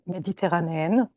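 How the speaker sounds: ordinary delivery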